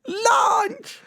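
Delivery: very high, stretched Southern LUNCH; Southern accent. Pretend. Co-host insisting it's food, in a high Southern accent. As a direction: squeal "Lunch" with a twang. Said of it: "with, with this accent".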